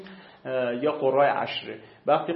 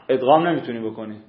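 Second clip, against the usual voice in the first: ordinary talk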